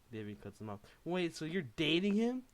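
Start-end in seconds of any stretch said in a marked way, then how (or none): 1.1-2.4 loud, high, furious demand; angry yell. Acted. Davey yelling at Takashi in jealous anger. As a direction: shout it at him, outraged. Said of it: "Davey yelled at him in anger".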